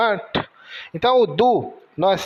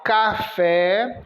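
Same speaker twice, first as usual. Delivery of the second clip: ordinary delivery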